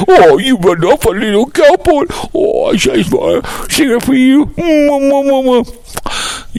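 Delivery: high sing-song mocking baby voice; mock crybaby. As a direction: tease him with high kissy baby noises. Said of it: "runs up like a pussy".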